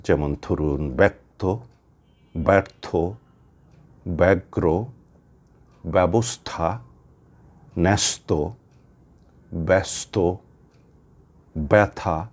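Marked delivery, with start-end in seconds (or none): 1.0-12.2 ব্যক্ত ব্যর্থ ব্যগ্র with য-ফলা as e, stressed first syllable; example words demo. Shown as a demonstration. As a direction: read each word apart, first sound pushed. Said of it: "আমি কিছু শব্দের কথা বলছি". (এ-কার উচ্চারণে শব্দগুলো স্পষ্ট করে বলা)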